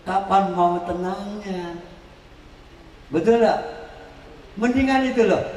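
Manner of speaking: ordinary talk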